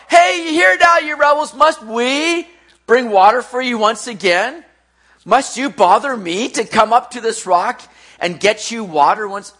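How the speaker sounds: hammed-up angry shout, high at first; Moses, harsh authority. Moses scolding the rebels at the rock, hammed-up harsh shout. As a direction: open at a shout, then loud indignant demands. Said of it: "this kind of authoritative voice".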